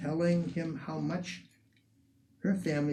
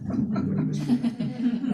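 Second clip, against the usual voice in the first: knowing chuckle; 7 semitones higher, 3 dB louder, much slower than usual. Real, not acted. Guide repeats the line, chuckling and slow.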